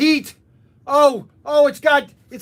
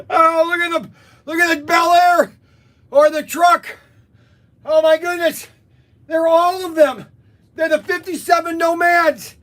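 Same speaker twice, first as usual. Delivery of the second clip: high, shouted amazement; excited raving; 4 semitones higher than usual. Real, not acted. Vlogger showing off each car sample, excitedly raving. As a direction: exclaim over each new treasure.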